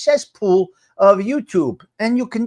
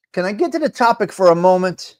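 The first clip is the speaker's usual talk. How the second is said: usual speaking voice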